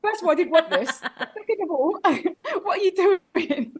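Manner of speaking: high, laughing mock indignation; giggling through a mock telling-off; laughing retort. To the window washer, laughing indignation. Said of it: "I said".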